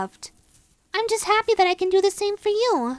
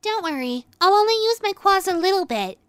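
ordinary talk

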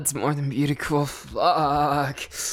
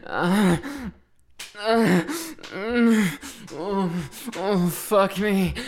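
higher moans drawn out slow; rising moans; 5 semitones higher, much slower than usual. Acted. Lover losing control with partner, drawn-out moans. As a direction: moan higher, stretch the last words.

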